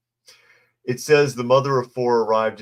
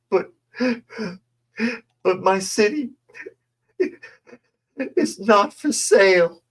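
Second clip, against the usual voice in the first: mock crying; 7 semitones higher than usual. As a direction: parody a tearful councilwoman, hammed-up. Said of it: "In a tearful exchange".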